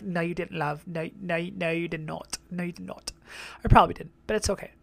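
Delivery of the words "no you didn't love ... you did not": soft, teasing mock English accent. Vlogger mocking English viewers, soft English accent. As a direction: imitate English fans scolding her in English accent. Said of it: "English people are gonna be like".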